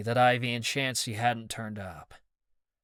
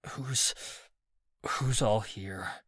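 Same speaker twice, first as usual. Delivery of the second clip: choked stammer; slower than usual. Acted. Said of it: "choked out".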